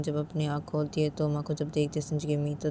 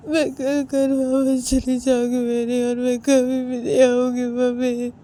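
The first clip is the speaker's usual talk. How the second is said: much higher and louder, crying openly; sobbing; 10 semitones higher, 9 dB louder, slower than usual. Acted. Uma pours out her hurt to her mother-in-law, sobbing. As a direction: let the voice break into sobs. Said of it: "रोते रोते".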